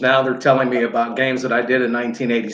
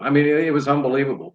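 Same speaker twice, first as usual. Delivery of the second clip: ordinary talk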